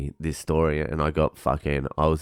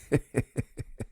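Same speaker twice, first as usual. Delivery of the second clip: soft, breath-only chuckle; hushed, amused snicker; laughing; 7 semitones higher, 9 dB quieter than usual. Real, not acted. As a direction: laugh quietly at your own insult. Laughs at own insult.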